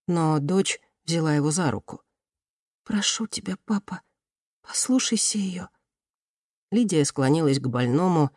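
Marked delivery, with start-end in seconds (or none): 2.8-5.7 higher, softer, gentle plea; pleading daughter. A narrator's reading. Daughter coaxing her father to listen, softly pleading. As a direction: plead softly in a raised pitch.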